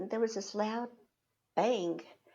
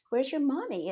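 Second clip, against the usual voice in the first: put-on lifted, higher-pitched question to a child; changed, friendlier tone; 5 semitones higher, 6 dB louder than usual. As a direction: switch to asking the little girls kindly. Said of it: "So I quickly changed my tone".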